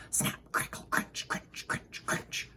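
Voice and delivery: soft unvoiced crunch sounds; whispered crunching. A narrator's reading.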